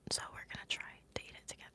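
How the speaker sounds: quick hushed whisper. Real, not acted. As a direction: whisper it fast and close. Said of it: "sorry, let me whisper".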